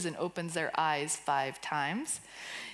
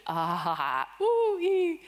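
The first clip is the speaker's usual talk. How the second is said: playful made-up sounds; 10 semitones higher, 6 dB louder, slower than usual. Shown as a demonstration. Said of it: "Just new languages".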